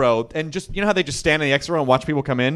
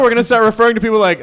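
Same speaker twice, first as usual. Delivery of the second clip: ordinary talk, nothing marked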